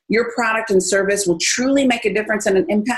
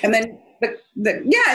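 ordinary talk

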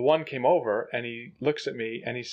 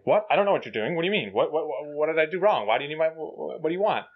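higher-pitched, flustered rush of questions; rapid-fire English; 4 semitones higher than usual. Retold or imitated. Driver bluffing a Russian officer in rapid English, fast and confused. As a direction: talk as fast as you can, stumbling over questions. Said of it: "in English as fast as I can".

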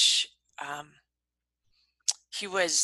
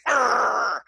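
grumpy growl; 3 semitones higher, 4 dB louder than usual. Retold or imitated. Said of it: "I'd be like".

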